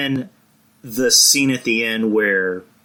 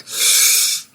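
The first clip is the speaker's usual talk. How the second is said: a long airy mock-swoon sigh. Clutching his chest at fan service, swooning sigh. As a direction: sigh like your heart just melted.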